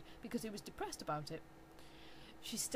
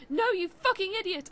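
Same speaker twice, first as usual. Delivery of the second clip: loud, high furious yell; angry shout; 10 semitones higher, 10 dB louder than usual. Acted. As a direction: scream the insult. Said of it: "I shouted".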